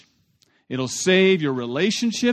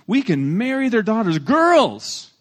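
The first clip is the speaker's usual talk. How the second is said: high, hammed-up selling point; excited sales pitch; 6 semitones higher, 4 dB louder, slower than usual. Shechem sells the deal to townsmen, high and eager. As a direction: lift it high and loud on the last word. Acts Hamor selling the proposal.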